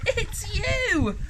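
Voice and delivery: very high-pitched exclamation; amused duckbills. A narrator's reading. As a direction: call it out high to the little dinosaur. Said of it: "they laughed and said".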